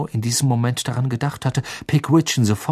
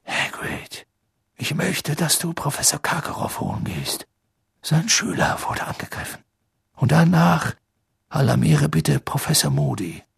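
hushed Dumbledore; 4 semitones higher than usual. A narrator's reading. Dumbledore gives urgent hushed orders.

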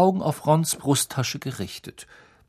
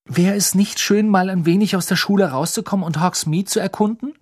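higher, livelier, louder voice; keen, rising question full of anticipation; eager girl character; 6 semitones higher, 4 dB louder than usual. A narrator's reading. Hermine turns to Harry about visiting Hogsmeade, eager.

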